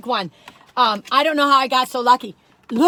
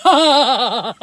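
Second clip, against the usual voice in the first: laughing; 6 dB louder than usual. Real, not acted. Laughing after evil voice.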